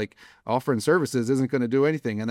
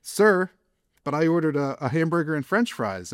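confused customer; 6 semitones higher than usual. Retold or imitated. Retelling a rant, the diner protests to the waiter in a high, baffled voice. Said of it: "And you say".

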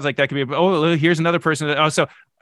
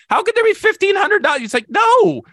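high, loud disbelieving squeal; incredulous outburst; 4 dB louder than usual. Retold or imitated. Shopper balking at fifteen hundred options, high incredulous squeal. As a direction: shriek it like you can't believe the number. Exasperated at 1500 search results.

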